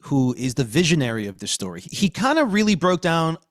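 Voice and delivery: usual speaking voice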